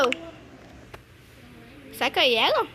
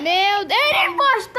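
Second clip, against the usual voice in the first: surpresa; 5 semitones higher, 7 dB louder than usual. Real, not acted. Descobre o impostor no jogo.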